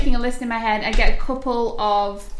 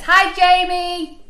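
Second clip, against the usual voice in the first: calling up the stairs; 8 semitones higher, 4 dB louder, much slower than usual. Retold or imitated. Shouting up the stairs.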